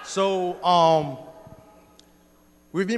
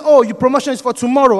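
loud, high-pitched exclamation; retold excited scream; 7 semitones higher, 12 dB louder than usual. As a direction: shout the news like an excited coworker. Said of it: "And he screamed again".

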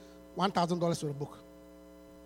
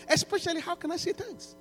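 quoted question pitched much higher; eager grateful reader; 10 semitones higher than usual. Retold or imitated. Imagined reader asking how to give thanks, voiced high. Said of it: "so that they can say".

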